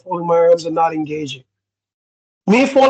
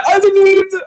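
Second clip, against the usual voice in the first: high mock protest; 13 semitones higher, 3 dB louder than usual. Someone interrupting mid-conversation.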